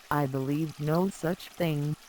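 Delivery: ordinary delivery, nothing marked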